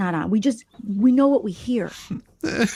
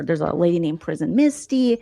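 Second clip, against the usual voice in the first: normal talking voice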